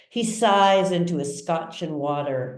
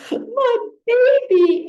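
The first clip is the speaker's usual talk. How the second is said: put-on very high, loud, slow wail of "My baby"; sobbing mother; 7 dB louder, slower than usual. A narrator's reading. Mother wailing over her son in the coma ward, high and slow. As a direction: cry it out like a melodramatic mother. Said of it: "Amy sobs".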